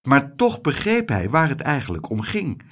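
ordinary delivery, nothing marked

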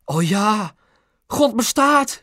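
high exclamation of elation; father exclaiming as if a prayer was answered; crying out. A narrator's reading. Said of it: "riep Pap". (verheugd uitroepen)

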